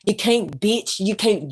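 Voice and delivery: ordinary talk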